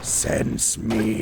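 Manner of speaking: drawn-out raspy demon call; creepy demon voice. Acted. Demon calling from the hole, creepy. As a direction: rasp it like a lurking demon. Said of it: "hearing this creepy voice coming from the hole".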